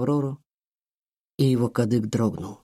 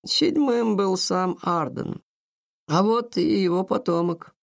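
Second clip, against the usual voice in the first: put-on slow old woman voice; a hint of a slow grandmother; 6 semitones higher, slower than usual. A narrator's reading. Grandmother names Randall's lineage.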